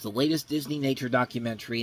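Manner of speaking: normal talking voice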